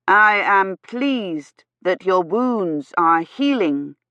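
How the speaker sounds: "I am pleased that your wounds are healing" very slow, each word stressed; every word emphasized. A narrator's reading. Gawyn to a lady he thinks deaf, every word over-emphasized. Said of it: "emphasizing every word".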